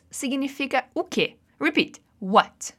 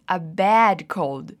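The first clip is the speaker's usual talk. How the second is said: bem devagar; 6 semitones lower, much slower than usual. Shown as a demonstration. Teacher models phrase.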